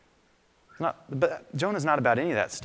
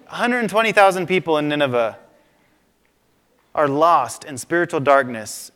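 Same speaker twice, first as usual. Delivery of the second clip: normal talking voice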